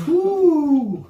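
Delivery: high, loud, drawn-out "ooh". Real, not acted. Contestant unsure of a quiz answer, high loud ooh. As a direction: let out a long, high ooh of doubt.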